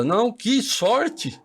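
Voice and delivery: sarcastic fix, high and slow; drawn-out ironic "que sorte"; mock correction. Joking self-correction after swearing. (corrige com ironia, agudo e lento)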